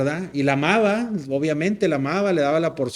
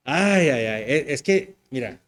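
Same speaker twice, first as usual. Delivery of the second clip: ordinary talk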